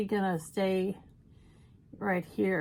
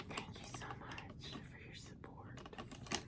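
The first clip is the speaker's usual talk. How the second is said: whisper; 17 dB quieter than usual. Acted. Whispered asides to viewers.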